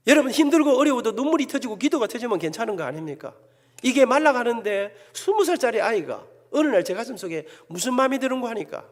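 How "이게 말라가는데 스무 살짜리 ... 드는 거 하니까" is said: ordinary talk, nothing marked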